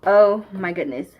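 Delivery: ordinary talk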